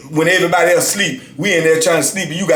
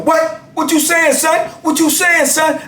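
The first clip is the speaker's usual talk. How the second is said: much higher, taunting; mock challenge; 13 semitones higher than usual. Inmate daring the narrator to say it again, high taunt. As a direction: dare him to repeat it.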